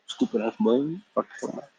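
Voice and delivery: put-on high, soft, fading old uncle grumble; mock scold. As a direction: grumble at the boy, trailing into a whisper. Iroh scolding Zuko.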